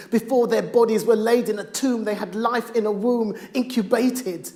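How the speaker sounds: rhythmic performed poem, swelling from hushed to loud; spoken word poem. Acted. Poet to the audience about his stillborn daughter, spoken word. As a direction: deliver it like a slam poem.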